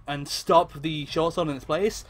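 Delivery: ordinary talk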